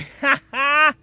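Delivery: high-pitched laugh burst; high cackle. Real, not acted. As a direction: giggle up high. Laughs at the fallen teepee.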